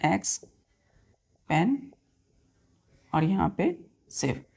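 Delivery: "एक्स", "पेन" and "सिव" as isolated syllables with long gaps; measured teaching breakdown of "एक्स", "पेन", "सिव". Shown as a demonstration. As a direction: point out "एक्स", "पेन", "सिव" one at a time. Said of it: "ये first syllable".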